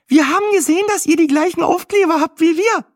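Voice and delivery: very high, loud gabbling woman; excited chatter. A narrator's reading. Weather woman greeting fellow travellers, excited chatter. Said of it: "schnatterte sie aufgeregt".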